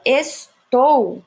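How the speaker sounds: slow, loud estou with weight on the final syllable; stress on TOU. Shown as a demonstration. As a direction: break estou into es and a strong TOU. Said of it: "o tou é a sílaba forte".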